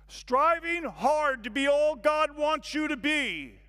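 slow, big, loud reading, pitched high; proclaiming the sign. Acted. Preacher reading the arena sign aloud, awed and loud. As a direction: announce it like a banner in lights.

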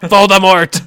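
loud high defiant shout; bellowed. Acted. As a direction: bellow the name at him. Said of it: "Harry bellowed".

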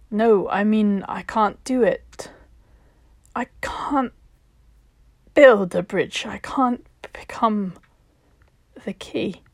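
rising panic, breaking into a whisper; she's on the edge and can't face it; desperate refusal. Acted. Cyan refusing at cliff edge.

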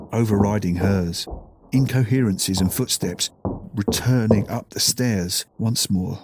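usual speaking voice

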